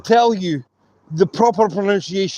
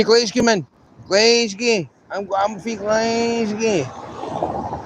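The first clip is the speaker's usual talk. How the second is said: Glaswegian accent; 3 semitones higher, slower than usual. Shown as a demonstration. Showing how locals say it, broad Glaswegian accent. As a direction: drag out the last Glasgow in thick Glaswegian. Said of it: "you come with a Glaswegian accent".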